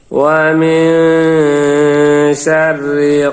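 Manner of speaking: slow sung chant, final qaf bounced at the stop; recitation with qalqala stop. Shown as a demonstration. Teacher shows the pause on খলাক with a bounce. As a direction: chant and echo the last letter as you pause. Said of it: "জজম হয়ে যাবে এবং কলকলা উচ্চারিত হবে".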